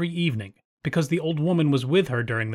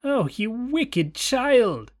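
high, slow outraged witch cry; 9 semitones higher, much slower than usual. A narrator's reading. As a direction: stretch it out in shocked fury. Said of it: "cried the witch".

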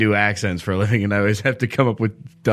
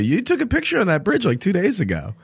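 mock alarmed; 10 semitones higher than usual. Retold worried text to friend.

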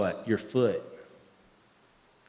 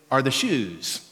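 ordinary delivery

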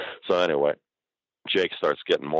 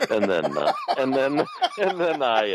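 laughing while talking; 9 semitones higher, slower than usual. Real, not acted. Laughing after his impression.

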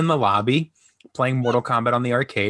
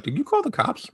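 high mock-stern mom; 13 semitones higher than usual. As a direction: quiz the kid like a surprised mom. Mom voiced in retold story.

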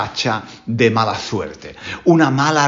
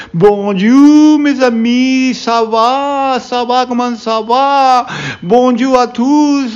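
high, bright-sounding hammed-up French greeting, slower at the end; pretend French teacher; 9 semitones higher, 4 dB louder, much slower than usual. Spanish teacher playing a French teacher greeting his class, hammed-up French. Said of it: "un profesor de francés, pues hablaría en francés".

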